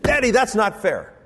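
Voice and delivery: lightly voiced pouting little girl; a hint of a five-year-old whining; child's protest. Father imitates daughter protesting, lightly voiced whine. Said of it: "she said".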